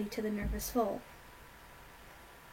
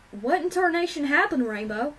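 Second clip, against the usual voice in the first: high, loud urgent question; demanding; 5 semitones higher, 7 dB louder than usual. A narrator's reading. Applejack questioning Rainbow about the hurt filly, demanding. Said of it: "Applejack demanded".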